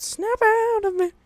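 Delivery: high yelled snap out of it. Acted. Shouting at lawn lovers to wake up, shrieked high and loud.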